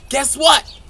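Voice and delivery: high, loud celebration, drawn out; announcing three thousand followers, shouting excitedly. Real, not acted. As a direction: yell the milestone in joy. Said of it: "I'm so excited".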